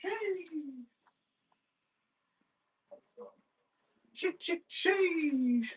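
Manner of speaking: squeaky high-pitched sheesh. Real, not acted. Exclaiming at a card.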